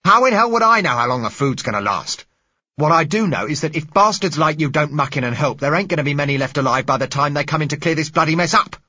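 loud, high, cursing rage; furious shout. Acted. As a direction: spit it out in anger. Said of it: "The man's voice was violent".

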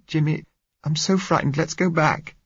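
put-on high-pitched young woman begging; frightened pleading. A narrator's reading. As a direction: plead in a high, fearful girl's voice. Frightened girl begs him to return.

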